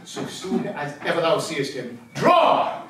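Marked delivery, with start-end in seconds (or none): 2.1-2.8 hearty command. Acted. Toby coaching Andrew to swear.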